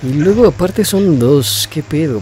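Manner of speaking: shocked at two enemies; alarmed surprise. Real, not acted. Más enemigos aparecen. (sorpresa alarmada)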